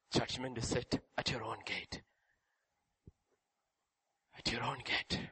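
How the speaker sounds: low, soft, solemn warning; hushed verdict. Acted. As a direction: say it quietly and gravely.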